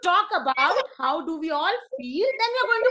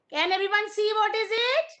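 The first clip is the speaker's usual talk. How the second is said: ordinary delivery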